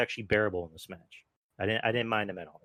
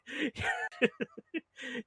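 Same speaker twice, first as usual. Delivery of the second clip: high burst of laughter; 4 dB quieter than usual. Real, not acted. Laughs at Owens grabbing Zayn.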